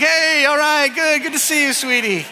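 high, loud, tumbling welcome; flustered happy greeting. Retold or imitated. Dad catching his headfirst toddler, acted excited yelp. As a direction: imitate a dad greeting a child who barrels into him.

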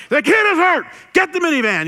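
high, very loud urgent yelling; panicked shout. Retold or imitated. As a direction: reenact a father shouting for help in an emergency. Panicked dad calling out, retold.

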